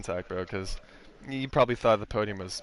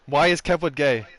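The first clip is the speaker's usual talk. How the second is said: reading a viewer question; 4 semitones higher, 7 dB louder than usual. Retold or imitated. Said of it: "Greg, what is your question?".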